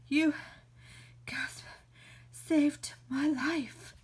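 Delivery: slow, whispered, out of breath; breathless whisper. Acted. Saved from the snake, thanking in a breathless whisper. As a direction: gasp out the thanks.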